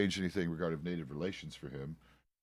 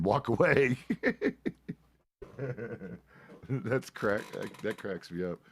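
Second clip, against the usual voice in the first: laughing; slower than usual. Real, not acted. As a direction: break into laughter at the end. Laughing at retold encounter.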